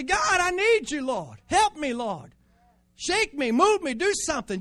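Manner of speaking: high, loud pleading cry, slowed; crying out to God. Shown as a demonstration. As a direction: scream your prayer out in the woods. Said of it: "just scream".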